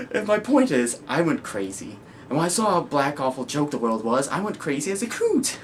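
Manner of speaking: manic, swinging confession; Joker. Acted. Joker explaining why he went crazy, manic and rising. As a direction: rant up into a high pitch.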